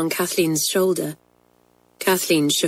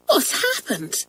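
higher-pitched alarmed question; horrified; 6 semitones higher, 3 dB quieter than usual. Acted. Twin wonders outside the door, horrified. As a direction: ask it in dismay, voice lifted. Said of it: "said Pat, horrified".

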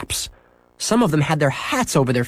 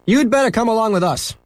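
higher, firm officer voice; policeman; 10 semitones higher than usual. Acted. Policeman telling Henry to come along, firm. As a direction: order him along kindly but firm. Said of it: "said the policeman".

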